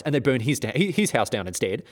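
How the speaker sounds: ordinary talk, nothing marked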